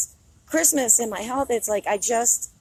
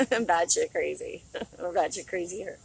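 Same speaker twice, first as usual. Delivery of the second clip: ordinary talk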